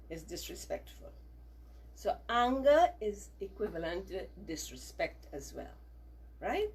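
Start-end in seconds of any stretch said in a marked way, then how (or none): none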